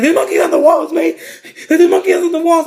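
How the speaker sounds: high, loud frantic insistence; acted-out frantic alarm; retold panic. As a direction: shout it like a raving friend. Friend now panicking about monkeys.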